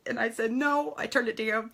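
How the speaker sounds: choking up while admitting her embarrassment; tearful. Real, not acted. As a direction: voice thick with real tears, fighting to keep talking. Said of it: "Sorry, I'm crying".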